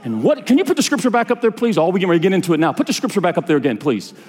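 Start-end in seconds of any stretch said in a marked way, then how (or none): none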